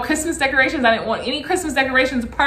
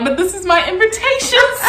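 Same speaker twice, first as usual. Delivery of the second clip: mock wail, pitched up; retold crying; 7 semitones higher than usual. Woman protesting at the box's contents, mock crying. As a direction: imitate a tearful protest. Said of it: "I just kept crying".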